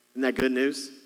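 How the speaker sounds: usual speaking voice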